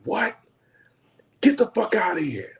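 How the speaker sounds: high-pitched, incredulous retort; mock upset outburst. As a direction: imitate someone upset and disbelieving. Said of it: "visibly upset".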